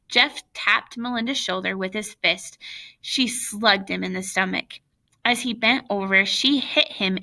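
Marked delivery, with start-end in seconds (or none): none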